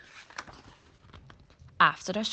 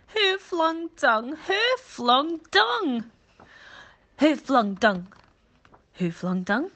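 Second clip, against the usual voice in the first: much higher, slow, louder repeated calls, fading at the end; crying out again and again; 9 semitones higher, 7 dB louder, slower than usual. Acted. Monkey calls the question over and over, high and slow, then fading. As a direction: call out desperately, then tire. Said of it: "he cried again and again".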